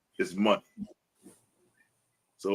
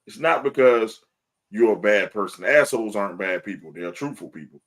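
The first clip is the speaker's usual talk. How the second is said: ordinary delivery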